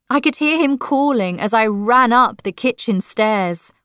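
usual speaking voice

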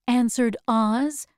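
ordinary delivery, nothing marked

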